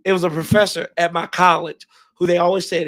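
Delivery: ordinary delivery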